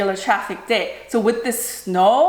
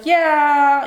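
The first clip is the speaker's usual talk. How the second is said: drawn-out, higher, louder hedging yeah; unsure yeah; 5 semitones higher, 5 dB louder than usual. Retold or imitated. Doctor answering whether she'll walk fine, unsure. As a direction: imitate someone stretching out a doubtful yes. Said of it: "he wasn't even, like, sure yet".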